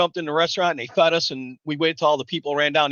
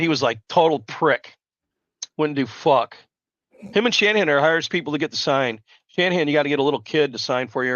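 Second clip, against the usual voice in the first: normal talking voice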